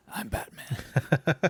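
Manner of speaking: slow, breathy gravel-voiced Batman growl; Batman impression. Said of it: "what's the quote in, in Batman Begins?".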